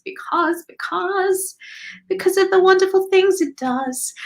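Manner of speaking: rising into a high, lilting quote; Pretty Woman quote. Retold or imitated. Quoting Pretty Woman to viewers in a high lilt. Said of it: "I love quoting Pretty Woman".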